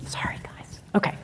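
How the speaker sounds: whispered apology to the room; whispered aside. Real, not acted. Lecturer apologizing to audience, whispered. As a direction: whisper it off-mic.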